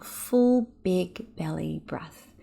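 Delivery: drawn-out, very slow breath cue. Shown as a demonstration. Host cueing the first deep inhale, very slow. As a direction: stretch each word of "Full big belly breath" like a long inhale. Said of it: "take a nice long deep breath".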